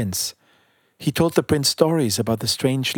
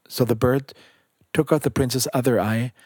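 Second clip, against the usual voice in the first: ordinary delivery, nothing marked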